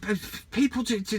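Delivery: usual speaking voice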